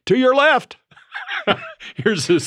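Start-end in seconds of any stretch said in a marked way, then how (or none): 0.1-0.8 high, loud cyclist's warning shout; calling out. Retold or imitated. E-biker overtaking serious road bikers, playful high loud call. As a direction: sing it out to riders ahead. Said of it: "coming up behind them on my e-bike and go".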